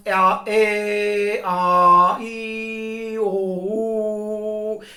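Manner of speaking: each vowel held long on a level pitch; steady, stretched-out vowel sounds; sustained vowel tones. Shown as a demonstration. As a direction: draw out pure vowels one after another. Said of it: "basic tones".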